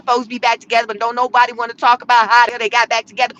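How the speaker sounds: usual speaking voice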